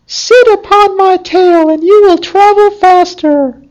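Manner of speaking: very high, squeaky little fox voice; talking fox. Acted. Fox warning the gardener's son, in a high squeaky voice. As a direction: pipe it up high like a tiny clever fox.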